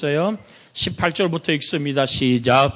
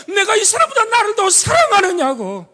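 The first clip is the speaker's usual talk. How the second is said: high, loud, weeping question; Jesus tearfully asking Peter whether he loves him more; tearful cry; 5 dB louder than usual. Acted.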